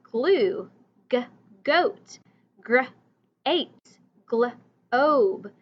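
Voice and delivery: teacher calling out G words; letter sound model. Shown as a demonstration. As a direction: call out each G word to the class. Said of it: "So G is for".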